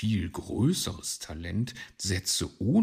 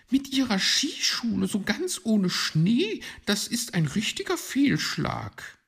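high, loud magpie voice; critical, high-voiced lady magpie; needling magpie; 14 semitones higher, 6 dB louder than usual. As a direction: scold him in a high bird voice.

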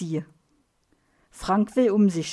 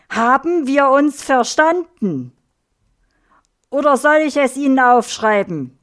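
louder, higher, stern scolding; angry reprimand; 4 semitones higher, 7 dB louder than usual. A narrator's reading. Papa Mike scolds Erna as he shakes her, loud and angry. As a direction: lay down the law, raising your voice.

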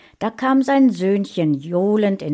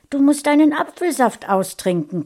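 much higher, motherly urging; a mother nudging her little boy to drink, higher; mother to child; 9 semitones higher, 5 dB louder than usual. A narrator's reading. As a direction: coax the kid to finish his juice. Mother speaks to her son.

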